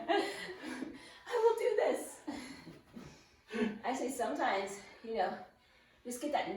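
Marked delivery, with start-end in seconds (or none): 0.1-2.2 laughing mock. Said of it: "pep talk mock".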